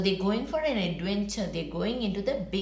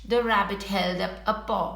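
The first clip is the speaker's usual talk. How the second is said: ordinary delivery, nothing marked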